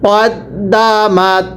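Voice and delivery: কদ্দামাত drawn out slowly, short ক then doubled দ্দ; slow spelled-out Quran reading. Shown as a demonstration. Teacher models কদ্দামাত slowly for the class. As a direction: stretch each sound of কদ্দামাত, doubling the দ. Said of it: "পড়ুন".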